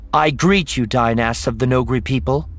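high, loud formal greeting. A narrator's reading. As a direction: address a whole crowd with regal force. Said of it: "she said in a loud voice".

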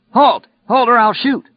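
high, loud warning shout; yelled police command. A narrator's reading. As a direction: yell a last warning at a fleeing suspect. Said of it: "when the trooper yelled".

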